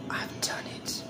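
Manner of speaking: breathy whisper of triumph. Real, not acted. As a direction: whisper it like a secret victory. Whispered finish of cooking.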